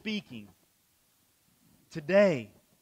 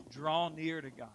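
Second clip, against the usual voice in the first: usual speaking voice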